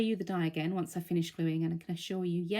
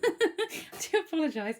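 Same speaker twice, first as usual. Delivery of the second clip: laughing; 11 semitones higher, 3 dB louder than usual. Real, not acted. Laughs at own breathlessness.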